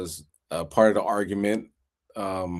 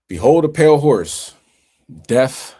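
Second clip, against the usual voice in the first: preaching proclamation; 3 semitones higher, 8 dB louder than usual. Acted. Quoting scripture dramatically.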